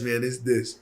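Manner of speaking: ordinary talk, nothing marked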